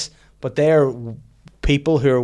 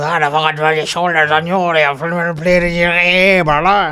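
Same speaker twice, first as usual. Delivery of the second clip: loud, high Irish local running into gibberish; hammed-up rural Irish gabble; thick rural Irish accent; 6 semitones higher, 8 dB louder than usual. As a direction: mimic an unintelligible country Irishman. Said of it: "I've never heard anything like it".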